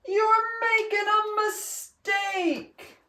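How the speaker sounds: high, slow, drawn-out bellow; a crowd bellowing a stern warning. A narrator's reading. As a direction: boom it at them as a warning. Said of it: "They bellowed".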